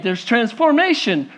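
usual speaking voice

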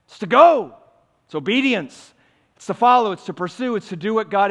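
loud, high emphatic declarations; forceful preaching. Real, not acted. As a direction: drive each answer home. Preaching the proper response.

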